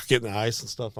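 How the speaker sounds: ordinary talk, nothing marked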